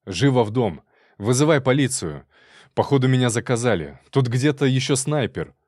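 loud, alarmed shouting; urgent orders. Acted. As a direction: shout the wife into the house. Urgently ordering wife to safety.

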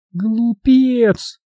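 high, drawn-out woman's contempt; high-pitched scorn, only lightly hissed; mild hissed insult. A narrator's reading. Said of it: "прошипела она".

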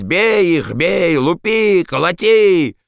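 high, drawn-out egging-on yell; drunken shouting. A narrator's reading. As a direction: cheer on the beating in a high-pitched shout. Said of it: "принялся кричать". (пьяный подстрекательский крик)

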